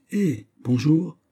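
high, drawn-out hello; stretched, high-pitched exclamation of welcome; sudden greeting. A narrator's reading. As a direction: greet him abruptly, voice swooping up. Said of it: "dit-il tout à coup".

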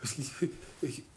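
startled high cry sinking to a faint stammer; stutter, losing voice as you go; dazed, halting stammer; trembling confusion. Acted. Trembling man asked if buried alive.

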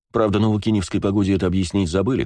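ordinary delivery, nothing marked

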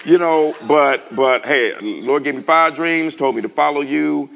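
loud, high, stretched-out plea; bold excited. Retold or imitated. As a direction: blurt it out, past caring how you look. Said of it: "I didn't care then".

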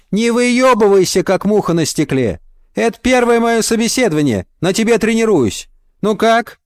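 high, punchy boss voice; brash interviewer, bossy. Acted. Woman boss telling off a boastful applicant, high and brash. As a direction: cut her down, high and brisk in spirit.

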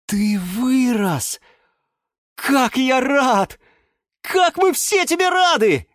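high, slow, rising delight; blurted joy. A narrator's reading. Yura greets the grown sprout, gushing with joy. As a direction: gush with happiness. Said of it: "выпалил он".

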